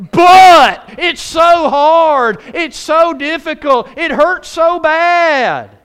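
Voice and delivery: loud, high, drawn-out mock lament; hammed-up wail, stretching every phrase slowly; mock complaining. Preacher mimics Christians with buts in the wrong places, hammed-up complaint.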